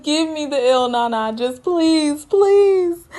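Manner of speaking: high, whiny mock begging. Recapper voices Dante pleading with Nicole, begging. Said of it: "he's begging in so many words".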